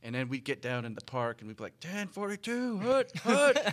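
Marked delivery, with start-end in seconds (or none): 1.8-3.7 shouted Scottish play call; Scottish accent. Retold or imitated. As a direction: bark it out like a Scottish kid playing quarterback. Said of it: "in a Scottish accent".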